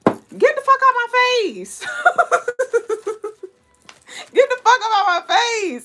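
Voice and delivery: high, laughing get-out line; laughing dismissal. Real, not acted. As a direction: laugh it off while shooing them away.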